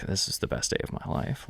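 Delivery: soft, whispery aside; hushed delight. Retold or imitated. Guest at grandma's table, privately thrilled, hushed. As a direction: drop to a near-whisper, quoting a happy thought.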